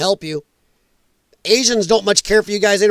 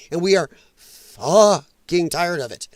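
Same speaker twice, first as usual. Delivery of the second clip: ordinary talk, nothing marked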